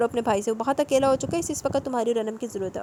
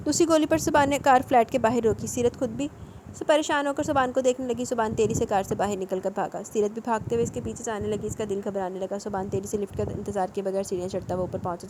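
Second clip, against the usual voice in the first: ordinary delivery, nothing marked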